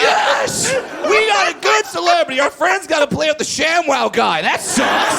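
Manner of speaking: high, loud gloating cheer; excited shout. Retold or imitated. Golfers gloating over their celebrity, loud high cheer. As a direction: crow like a delighted fan.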